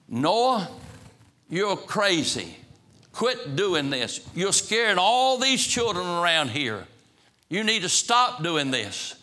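acted angry rebuke. A narrator's reading. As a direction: scold him as a storyteller playing an alarmed objector. Acted rebuke of Noah in story.

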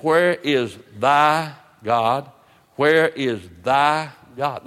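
WHERE and THY drawn out with weight; slow, weighty quote with THY stretched out; slow, emphatic question. Retold or imitated. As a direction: lean hard on THY, pausing before God. Preacher quotes the probing scripture question.

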